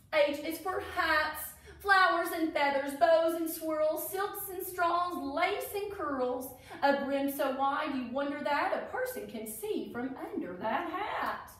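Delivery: sing-song rhyme read aloud; reading to children. A narrator's reading. Teacher reading a Derby rhyme book to kids, sing-song. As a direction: read the verse like a storybook.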